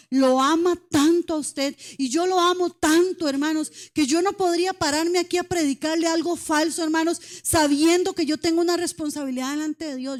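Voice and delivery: loud, impassioned sermon voice; fervent preaching. Real, not acted. Pastora predicando con fervor a los hermanos. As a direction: press every word home to the flock.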